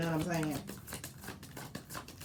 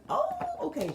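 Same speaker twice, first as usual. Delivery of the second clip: squeaky, very high oh okay; giddy girl accepting a lunch date; excited. Retold or imitated. Girlfriend thrilled he is taking her to lunch, squealing. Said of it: "She's so excited".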